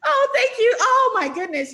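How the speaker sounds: high, loud, overwhelmed thanks; real happy-tears outburst; tearful gratitude. Real, not acted. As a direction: gush your thanks while tearing up. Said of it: "I told you I would tear up".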